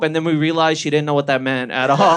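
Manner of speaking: normal talking voice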